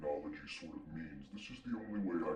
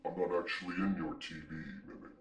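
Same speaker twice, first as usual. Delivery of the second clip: deep-voiced demon. Demon greets his host through the TV, deep-voiced. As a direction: play a composed demon with a booming bass. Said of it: "So what's with the voice?".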